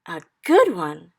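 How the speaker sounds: loud, slow indignant cry; cried. Acted. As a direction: belt out the offended question slowly. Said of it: "he cried".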